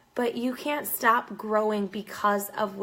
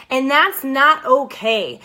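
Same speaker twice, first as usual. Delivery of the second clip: slow and louder, hitting every word; firm emphasis; 2 semitones higher, 6 dB louder, slower than usual. Real, not acted. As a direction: plant your feet on each word. Emphatic point in her rant.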